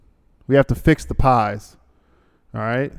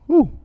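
sharp whew. Real, not acted. Reading a long ad aloud.

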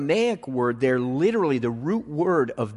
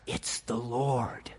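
awed whisper; 2 semitones lower, 10 dB quieter, slower than usual. Retold or imitated. Disciple recognizes Jesus, awed.

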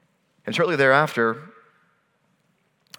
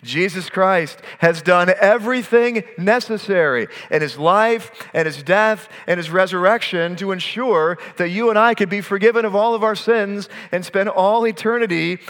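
louder, higher preaching build; preaching at full force; 7 semitones higher, 4 dB louder than usual. Acted. Preacher closing the sermon, full force. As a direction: drive the close home to the congregation.

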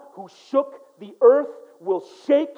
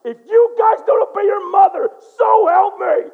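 loud, high stern yell; father yelling at the kids to obey their mother; angry father yell; 10 semitones higher, 9 dB louder than usual. Retold or imitated. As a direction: lay down the law at full volume. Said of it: "And I'm like".

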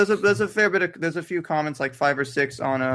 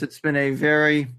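ordinary talk

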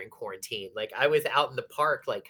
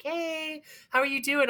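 mock-cheery hello pitched higher; breezy friendly greeting; 13 semitones higher than usual. David texting her out of nowhere, in a high, mock-cheery greeting. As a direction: play the casual old acquaintance checking in, voice lifted high. David voiced reaching out unexpectedly.

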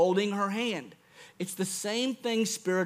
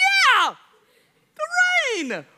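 very high, loud joyful shout; excited cheer; 8 dB louder, much slower than usual. Retold or imitated. Dad cheers with his daughter in the rain. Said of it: "I was like".

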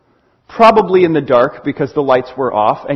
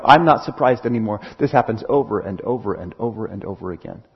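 low, soft, tired sigh of a voice; a jaded voice, much lower and softer; weary shrug; 9 semitones lower, 7 dB quieter, faster than usual. Retold or imitated. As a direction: sound utterly unbothered and worn out. Weary listener voiced by preacher.

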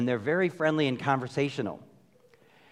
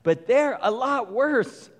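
exasperated prophet; 7 semitones higher than usual. Retold or imitated. Habakkuk complaining to God, indignant high protest. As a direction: plead with God, indignant and perplexed. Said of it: "He's like".

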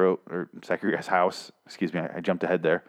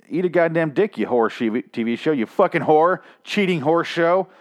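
angry rant; 6 semitones higher than usual. Real, not acted. Ranting at the TV show.

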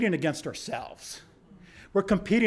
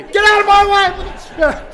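loud, high yell to clear the path; shouting at the crowd; 14 semitones higher, 10 dB louder than usual. Retold or imitated. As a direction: bark it at the runners coming at you. Shoving through oncoming runners.

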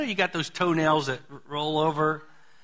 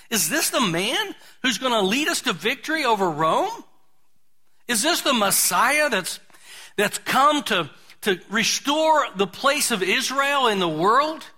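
bewildered Peter; 5 semitones higher than usual. Retold or imitated. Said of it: "Peter scratching his head saying".

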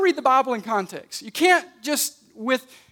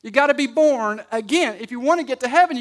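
ordinary delivery

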